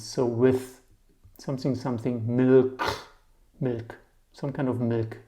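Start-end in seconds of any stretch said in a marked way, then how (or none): none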